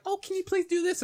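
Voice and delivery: very high mock-polite plea; sweet-talking customer. Customer at first asking the cashier nicely, high mock-polite voice. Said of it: "first she's like".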